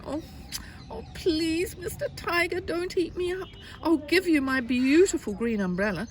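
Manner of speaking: high-pitched storybook plea; pleading little boy. Acted. Little boy begging tiger for his life, high pleading. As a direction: beg in a small, high child's voice.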